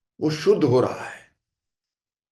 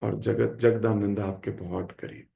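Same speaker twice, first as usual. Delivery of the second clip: lower, softer, humble plea; humble folded hands; 7 semitones lower, 5 dB quieter than usual. Retold or imitated. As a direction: speak with joined hands, meek and sincere. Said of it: "हाथ जोड़ के खड़े हो गए".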